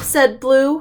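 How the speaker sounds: plain reading voice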